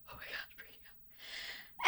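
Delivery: breathless whisper, half to herself; whispered freak-out. Retold or imitated. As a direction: hush it like a secret thrill. Excited guest agrees to come on.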